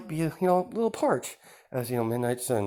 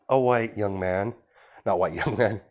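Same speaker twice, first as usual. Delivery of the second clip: ordinary delivery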